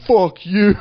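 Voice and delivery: mock outraged yell, high and loud; retold angry yell. Imitating the lady who stopped to answer, angry yell. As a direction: throw it back as a hammed-up shout. Retold street row, lady's comeback.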